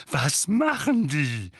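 slow, high and loud; impatient demand. A narrator's reading. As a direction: press hard for the answer. Vernon presses.

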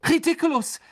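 high, barked spell; Ron. A narrator's reading. Ron casting at the giant spider, barking. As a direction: bark the spell out in one burst. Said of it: "bellte Ron".

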